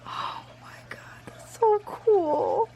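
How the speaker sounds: slow whisper, rising in wonder; hushed, tearful delight; awed whisper. Real, not acted. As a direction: breathe it out amazed. Touched by the gift.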